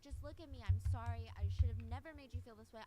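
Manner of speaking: imitating a loud, desperate apology; loud pleading apology. Boyfriend chasing girlfriend, begging her to forgive him: loud imitated pleading. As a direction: belt out the pleading sorry like he's chasing her.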